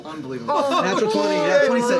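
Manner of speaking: very high, excited announcement; thrilled disbelief. Real, not acted. Player announcing a natural twenty, thrilled. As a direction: crow over an amazing roll.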